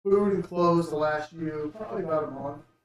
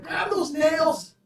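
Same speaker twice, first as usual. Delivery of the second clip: shouted order; 9 semitones higher, 3 dB louder than usual. Acted. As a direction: bark the order like a hillbilly. GM voices hillbilly giving orders.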